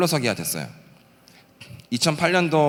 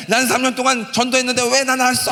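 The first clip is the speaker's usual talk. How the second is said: wailing complaint; 10 semitones higher, 3 dB louder than usual. Retold or imitated. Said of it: "하면서 막 울고불고".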